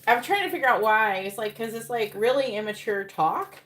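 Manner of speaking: loud incredulous venting at the silly phrase; exasperated outburst. Real, not acted. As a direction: let the disbelief burst out loud. Exasperated rant about immature phrase.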